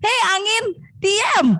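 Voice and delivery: high, playful scolding yell; acted yelling. Big mouse shouts at the wind to be silent, mock yell. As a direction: order the wind to hush. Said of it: "Dia teriak-teriak".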